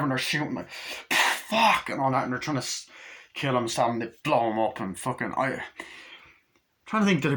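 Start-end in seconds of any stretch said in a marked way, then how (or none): 1.1-1.9 high mock yell; acted panic. Crew shooting at the thing, acted panicked yell. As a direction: shout it in the thick of a firefight.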